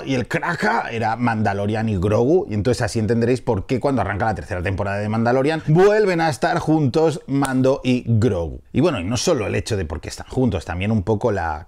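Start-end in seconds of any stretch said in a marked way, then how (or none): none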